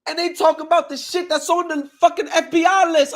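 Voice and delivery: high, loud, outraged yell; disbelieving shout. Retold or imitated. Retelling watching a livestream, shouting high in outrage. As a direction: yell it like you can't believe your ears. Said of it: "I'm like".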